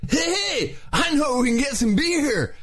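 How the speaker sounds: very high, drawn-out surfer dude. Shown as a demonstration. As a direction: drag it out like a surfer kid. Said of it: "the whole thing in a surfer's voice".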